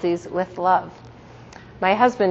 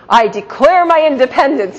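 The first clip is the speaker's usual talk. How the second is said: angry declaration; 6 semitones higher, 5 dB louder than usual. Retold or imitated. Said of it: "she presented it to me angrily".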